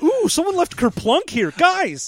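high-pitched mock delight; excited soldier. Acting soldiers delighted by the game.

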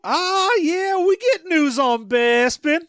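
slow, high cartoon prospector drawl; old prospector. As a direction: ham up a backwoods old miner. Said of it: "he talks like an old prospector".

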